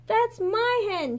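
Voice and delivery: slow, high protest; crying out. A narrator's reading. Mei Mei claiming her hen from the fisherman, a slow high protest. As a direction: plead it at him. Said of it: "she cried to the fisherman".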